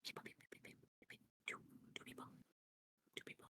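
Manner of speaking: frantic hushed whisper; panicked whisper. Acted. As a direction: whisper in alarm, repeating fast. Character panics at intruders.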